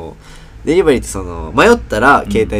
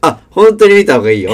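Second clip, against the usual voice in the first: louder, pressing the advice with a laugh; exasperated retold insistence; 7 semitones higher, 8 dB louder than usual. To the new trainee who won't check the map, exasperated louder warning. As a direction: repeat the warning, fed up and half laughing. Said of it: "って言って".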